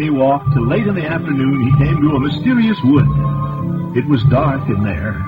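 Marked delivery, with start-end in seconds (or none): none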